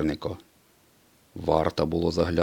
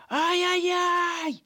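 extremely high, slow squealing cry; frightened squeal; much slower than usual. A narrator's reading. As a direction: squeal and flee. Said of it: "заверещав блискавка".

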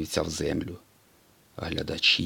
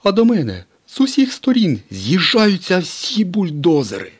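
much higher, louder tow-truck voice; Mater telling his tale; 12 semitones higher, 7 dB louder than usual. A narrator's reading. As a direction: build up the danger like a bragging truck. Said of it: "продовжив розповідь Сирник".

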